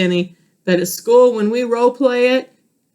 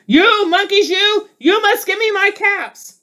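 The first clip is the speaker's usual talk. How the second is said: mock angry shout; 11 semitones higher than usual. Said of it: "stamped both his feet and shouted".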